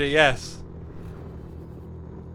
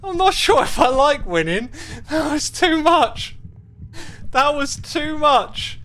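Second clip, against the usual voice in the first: overwhelmed; 10 semitones higher, 5 dB louder than usual. Real, not acted. Overwhelmed after winning.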